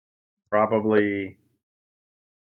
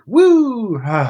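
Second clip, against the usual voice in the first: excited cheer; 10 semitones higher, 6 dB louder than usual. Retold or imitated. Mock cheer ending a campaign.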